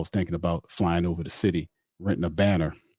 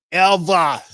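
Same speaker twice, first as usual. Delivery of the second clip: shouted ever; 12 semitones higher, 8 dB louder than usual. Acted. Repeated word shouted.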